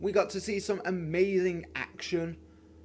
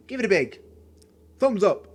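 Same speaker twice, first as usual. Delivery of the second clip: louder and higher call for a thumbs up; energetic outro; 4 semitones higher, 8 dB louder than usual. Real, not acted. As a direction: punch out thumbs up to the viewers.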